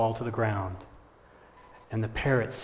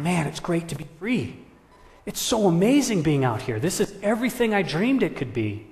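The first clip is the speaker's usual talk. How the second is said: higher, thrilled to be free; elated, high-pitched exclaiming; excited parrot; 5 semitones higher, 4 dB louder than usual. Acted. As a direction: revel in new freedom, pitch lifted. Freed parrot delights in flying.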